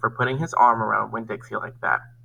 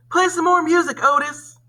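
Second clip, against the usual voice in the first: higher, louder call across the room; shouted; 4 dB louder than usual. Acted. Opal shouts to Otis over the noise, raised call. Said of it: "I shouted over to Winn-Dixie".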